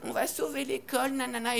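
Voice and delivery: mock their goody-goody tone; mocking eager kids. Kids in the dorm mocked as school heroes. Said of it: "ils étaient là en mode".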